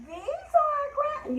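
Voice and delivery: very high, slow grandma squeak; squeaky high rhyme voice; high-pitched voice. Shown as a demonstration. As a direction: pitch it way up, loud and drawn out. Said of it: "use your high-pitched voice".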